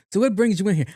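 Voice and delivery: high-pitched doctor's greeting; mimicked doctor. Doctor walking in to ask the patient, high mimicry. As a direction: put on an oblivious doctor up high.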